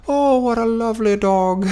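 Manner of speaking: put-on very high, sweet old Irish lady; attempted Irish accent. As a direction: coo in a high, badly faked Irish lilt. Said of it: "little old wrinkly lady".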